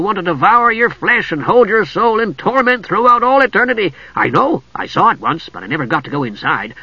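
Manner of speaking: high, sing-along relish of ghastly horrors; gleeful kender. A narrator's reading. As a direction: tell the horrors like a wonderful treat.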